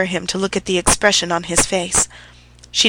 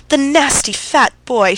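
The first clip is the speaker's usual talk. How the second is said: with a sob; 6 semitones higher, 3 dB louder than usual. A narrator's reading. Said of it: "she said with a sob".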